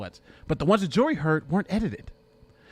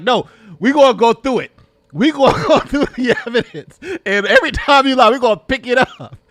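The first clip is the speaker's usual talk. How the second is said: loud, high, laughing mock interviewer; laughing impression of interviewer; 4 semitones higher, 7 dB louder than usual. Streamer voicing the interviewer, laughing. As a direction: play her tough, laughing through it. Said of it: "Savannah's like".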